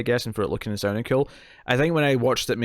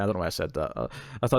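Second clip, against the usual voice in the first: ordinary delivery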